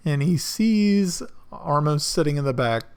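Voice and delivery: ordinary delivery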